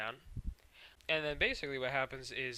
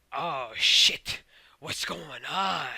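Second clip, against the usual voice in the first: loud, stretched-out exclamation; hammed-up drawn-out dismay; mock alarm; 9 dB louder, much slower than usual. Gems alarmed by Rubies' arrival.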